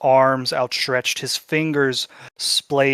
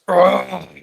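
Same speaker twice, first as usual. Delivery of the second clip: sharp pained grunt, louder and higher; pained yell; 6 semitones higher, 7 dB louder than usual. A narrator's reading. Executioner falling with a loud pained yell. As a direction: cry out as the knee gives way. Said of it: "three different voices yelling".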